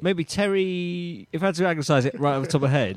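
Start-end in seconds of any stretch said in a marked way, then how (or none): 0.0-1.3 slow, drawn-out suggestion; slow musing. Acted. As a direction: savour the name slowly.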